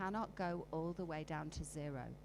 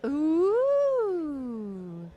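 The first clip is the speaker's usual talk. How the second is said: loud high ooh from the room; 8 semitones higher, 13 dB louder than usual. Shown as a demonstration. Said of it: "Who noticed".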